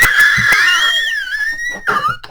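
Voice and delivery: long, very high wheezing squeal of laughter; helpless laughter. Real, not acted. Teller at his own punchline, squealing laughter. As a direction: crack up completely at the punchline.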